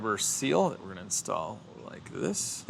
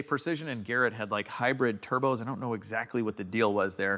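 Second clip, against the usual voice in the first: ordinary talk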